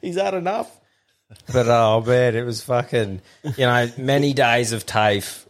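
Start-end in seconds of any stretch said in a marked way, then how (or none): 0.0-0.7 higher mock plea to stop. Pulling attacker off beaten mate.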